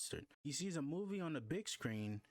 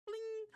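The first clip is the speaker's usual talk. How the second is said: high sung chime like a phone alert; ping it out high like a game notification. Acted.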